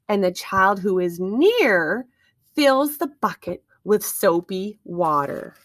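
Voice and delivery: normal talking voice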